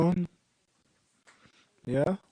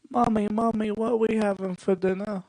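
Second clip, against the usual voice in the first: higher, put-on little kid voice; mock child calling for mommy; 6 semitones higher than usual. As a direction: pester mom like an eager kid. Acts a British child asking mother.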